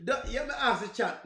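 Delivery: ordinary delivery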